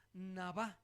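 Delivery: nabá, stressed on the final á; acento en la última A. Shown as a demonstration. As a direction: say "nabá" with the weight on the last syllable. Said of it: "con acento en la A". (nabá, con acento en la á final)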